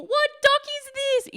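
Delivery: very high, held-back mock yell. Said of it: "yelling out the window to people".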